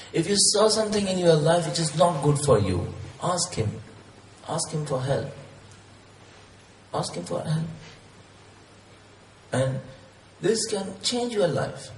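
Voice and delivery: ordinary talk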